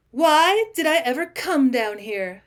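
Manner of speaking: milk it like a stage heroine; dramatic. A narrator's reading. Agnes seizing attention from the room, slow and dramatic. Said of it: "she asked dramatically".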